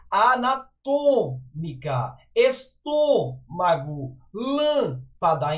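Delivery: slow, stretched closed ô and â in "anatômica, estômago, lâmpada"; closed vowels. Shown as a demonstration. Teacher showing the circumflex, closed vowels in "anatômica, estômago, lâmpada". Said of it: "a pronúncia é fechada".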